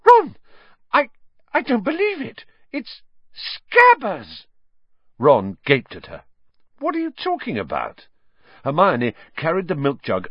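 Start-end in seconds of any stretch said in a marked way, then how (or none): 0.1-4.5 shrieking, far higher and louder. Acted. Hermione finds Scabbers in the milk jug, shrieking in shock. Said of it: "she let out a shriek". 6.8-8.1 baffled. Acted. Ron baffled by Hermione's shriek.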